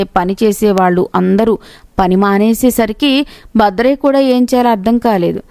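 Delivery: plain reading voice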